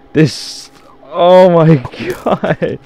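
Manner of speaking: slow, high, loud laugh-through words. Real, not acted. Gamer cracking up at his own building blunder. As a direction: laugh helplessly at your own mistake.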